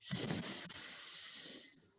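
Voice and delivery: a long unvoiced hiss, sss; hissed s sound. Shown as a demonstration. Teacher demonstrates the s sound, a long hiss. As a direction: hiss the letter s like a snake. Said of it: "Can you do that?".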